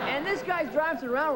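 ordinary talk

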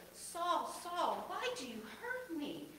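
a voice calling down from heaven; voice of Jesus. A narrator's reading. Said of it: "When he heard this voice".

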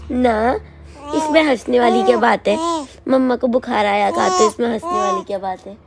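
loud, fast playful scolding; mock scolding. Real, not acted. Owner playfully scolding the dog for laughing, loud mock scolding. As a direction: pretend to tell the dog off, teasing.

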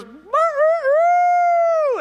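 high, loud, long drawn-out crow; rooster crow. Pretend. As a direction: crow at the top of your voice. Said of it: "So he just goes".

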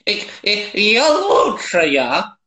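put-on high, slow old granny on a bench; old babushka voice. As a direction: croak it like a gossiping granny. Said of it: "Скажи, как бабка на лавке".

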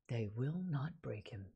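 low, hushed, deliberate repetition; solemn echo. Real, not acted. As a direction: say it softly and low, with gravity. Repeats quoted line for solemn emphasis.

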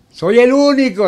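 soaring proud boast; proud exclaim. Real, not acted. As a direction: crow it out, high and loud. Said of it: "el mejor pregonero".

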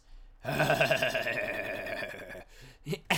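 hammed-up villain laugh; evil menacing laugh. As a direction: cackle like a movie villain. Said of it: "evil menacing laugh".

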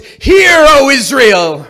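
booming priestly call to arms; rallying shout. Retold or imitated. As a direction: shout it to the whole army. Priest rallies the army.

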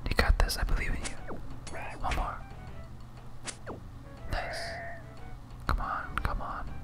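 ordinary delivery

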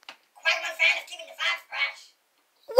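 faint electronic playback; recorded playback. Shown as a demonstration. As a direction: play the line back like a toy recorder. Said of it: "say something and I will play it back".

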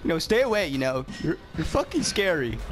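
normal talking voice

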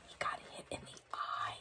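hushed whisper; a whispered aside to the little one. Real, not acted. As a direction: breathe it as a secret. Aside to the child listener.

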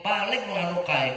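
ordinary talk, nothing marked

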